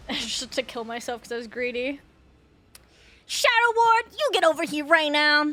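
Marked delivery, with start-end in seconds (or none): 3.3-5.4 loud, high scolding mom. Pretend. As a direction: call the kids out like a stern mother. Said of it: "Doing a mom voice?".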